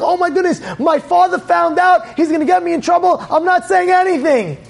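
high, hurried worry; acted high-pitched alarm; panicked boy. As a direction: fret in a high voice about getting caught. Hiding boy panics at being found.

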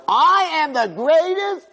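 a haughty servant showing off to his master's wife; arrogant boasting. Retold or imitated. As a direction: puff yourself up and crow to her. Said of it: "He's telling Potiphar's wife".

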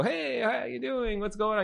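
put-on high, drawn-out Italian-accented greeting; terrible Italian accent. Pizza guy greeting walk-in customers, bad Italian accent. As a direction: put on a hammy Italian accent, stretching the hello. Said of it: "like, your Italian accent".

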